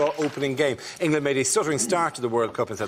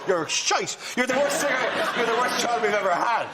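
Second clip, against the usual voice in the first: mock parental tirade, pitched up; turning on the child; 7 semitones higher than usual. Parent berating a child kicked out of auditions, mock outrage. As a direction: berate the child in hammed-up disgust. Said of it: "and then you go".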